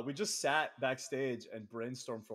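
ordinary talk, nothing marked

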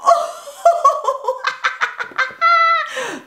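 high bird laughing cackle; high cackling bird laugh; kookaburra cackle. A narrator's reading.

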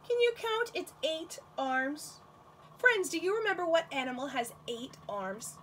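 ordinary talk

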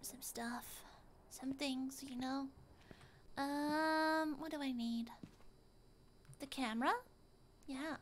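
quiet muttering. Real, not acted. Quiet muttering while searching.

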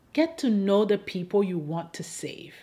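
normal talking voice

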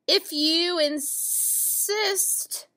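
drawn-out, slow hissing snake. Acted. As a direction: stretch it out like a lazy snake. Snake answers ladybug in story.

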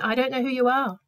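a lightly changed voice, a touch stern; unseen voice refusing. Acted. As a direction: refuse entry from behind the gates. Voice behind gates refuses.

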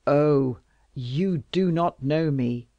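loud, slow, exasperated outburst; exasperated cry. Acted. King to Louise, exasperated and loud. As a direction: let the exasperation burst out. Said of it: "cried the king, exasperated".

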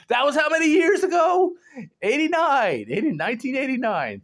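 high and loud, amazed; amazed exclamation. Real, not acted. Vlogger counts the years since the dance, loud amazement. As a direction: exclaim it in disbelief.

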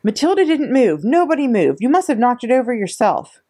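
high, loud group protest; children accusing the headmistress loudly; crying out. A narrator's reading. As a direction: defend her all together, indignant. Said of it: "they cried out".

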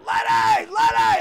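very high, loud mock holler; imitated fan shouts. Fans calling to the comedian at a Stones concert, shouting. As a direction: yell it like fans across a concert crowd. Retold crowd calling out to him.

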